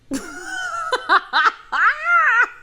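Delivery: loud high laugh; big high laugh. Real, not acted. Laughing at the passive aggression.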